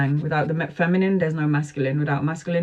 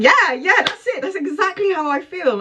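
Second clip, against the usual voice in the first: much higher, louder excited client voice; eager client; 12 semitones higher, 3 dB louder than usual. Retold or imitated. Tarot reader imitating an eager client agreeing, high and excited. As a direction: gush like a client who's sure. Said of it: "And then they're like".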